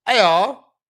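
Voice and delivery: high, loud British caricature; hammed-up British greeting; mock British accent. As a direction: mimic Spider-Man with an exaggerated British lilt. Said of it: "I didn't know Spider-Man spoke like that".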